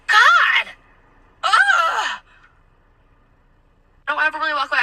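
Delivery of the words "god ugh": high, drawn-out mock revulsion; exaggerated exasperated groan; disgusted groan. Customer revolted by server's TikTok talk, disgusted.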